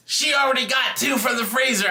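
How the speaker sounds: high, shouted accusation, imitated; outraged tattling. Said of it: "the lady that was giving me the evil eye".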